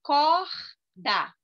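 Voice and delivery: "Corda" split into cor and da; two clear beats on "Corda"; syllable by syllable. Shown as a demonstration. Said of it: "duas sílabas".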